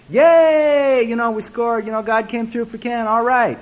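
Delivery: high, loud mock celebration; acted-out jubilant crowd, starting with a whoop; excited cheer. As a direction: cheer like the team just scored. Acts out the group celebrating again.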